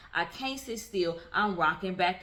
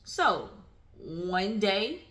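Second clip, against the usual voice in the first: usual speaking voice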